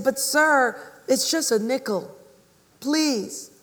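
put-on high, imploring child's voice; pleading little girl. Little girl selling cookies to the cobbler, pleading.

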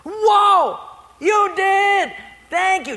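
high, loud astonishment; hammed-up delighted surprise; amazed. Poor lady finding groceries on her porch, amazed.

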